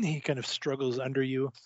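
normal talking voice

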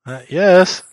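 calling loudly through a closed door; shouting through a door. Acted. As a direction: yell it through the door from the kitchen. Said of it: "Are you just shouting through the door?".

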